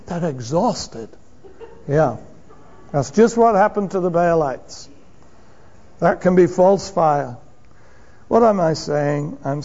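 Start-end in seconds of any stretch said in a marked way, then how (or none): none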